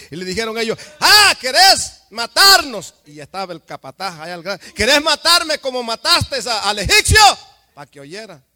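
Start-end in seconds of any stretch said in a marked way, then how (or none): 1.0-2.9 gritando furioso. Acted. Hebreos peleando gritan a Moisés. 4.7-7.2 grito acusador. Acted. Hebreo grita a Moisés.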